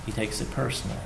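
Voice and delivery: hushed repeat. Acted. Preacher repeats, softer.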